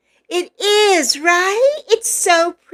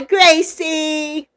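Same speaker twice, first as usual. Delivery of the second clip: ordinary talk, nothing marked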